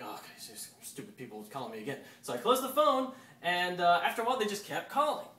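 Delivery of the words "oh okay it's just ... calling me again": fast, soft grumble under the breath; fed-up mutter. Retold or imitated. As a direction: sigh it off to yourself, quickly. Muttering to himself at spam.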